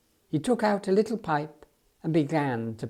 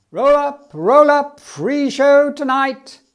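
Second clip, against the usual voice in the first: much higher, louder slow barker's call; circus barker; 9 semitones higher, 10 dB louder, slower than usual. Acted. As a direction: drum up the crowd like a ringmaster. Circus owner announces a free show.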